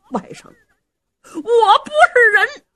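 high, slow, drawn-out wailing confession; tearful remorse. Acted. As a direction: wail in shame to your nephew. Remorseful uncle slaps himself, confessing. (拖长声音哭着悔恨)

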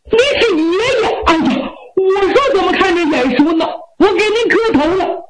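high, eager youthful voice; excited young man. Acted. Youth recognizing his grandfather, high and excited. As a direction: burst out high in sudden recognition.

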